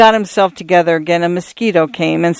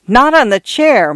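high-pitched, urgent warning; raised voice, pitched well up in alarm; crying out; 8 semitones higher, 4 dB louder than usual. A narrator's reading. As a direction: call out a sudden objection. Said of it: "Missus Stoner cried".

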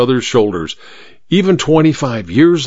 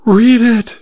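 urgent; 12 semitones higher, 4 dB louder than usual. Acted. Housemaid urgently hands over paper.

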